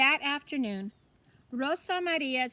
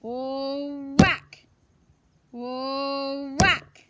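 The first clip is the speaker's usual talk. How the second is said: much louder shouted whack; cheering whacks; 7 dB louder, much slower than usual. Acted. Kids cheering at the swinging stick, loud shouts. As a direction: belt out each hit like a crowd. Children cheering piñata hits.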